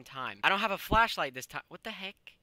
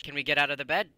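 ordinary talk

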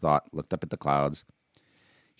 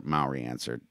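usual speaking voice

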